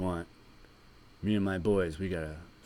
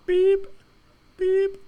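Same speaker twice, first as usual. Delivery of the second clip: very high loud beeping; reversing truck beeps; 12 dB louder than usual. Acted. Truck backing up.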